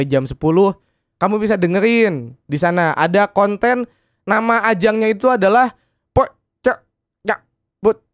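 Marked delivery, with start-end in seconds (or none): 6.1-8.0 choppy broken phone line; breaking up call. Acted. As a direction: chop the words like a dropping call. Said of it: "putus-putus suaranya".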